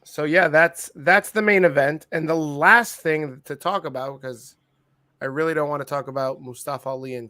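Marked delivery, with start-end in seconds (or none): none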